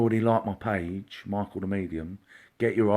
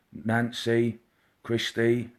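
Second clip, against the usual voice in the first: ordinary talk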